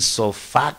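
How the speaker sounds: "sofá" with the accent on the last A; stress on final A. Shown as a demonstration. Teacher showing others' variant, "sofá" stressed on final A. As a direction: lean on the closing A of "sofá". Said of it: "con acento en la A".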